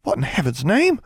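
high-pitched shocked exclamation; high gasped-in surprise. A narrator's reading. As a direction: react aghast, voice rising. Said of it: "and then she gasped".

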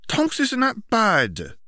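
put-on slow, high insistent girl; insisting girl voice. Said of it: "Ginny insisted".

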